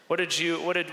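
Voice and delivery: ordinary talk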